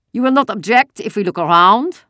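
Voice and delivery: put-on loud, high, harsh accented soldier; a question that is really an order. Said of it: "The voice was harsh".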